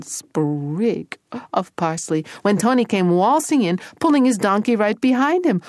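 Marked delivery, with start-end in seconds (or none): none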